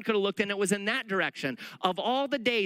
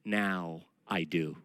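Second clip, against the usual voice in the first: much lower, very slow deadpan; girl's deadpan answer; 12 semitones lower, 4 dB quieter, much slower than usual. Retold or imitated. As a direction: drag it out low for the punchline.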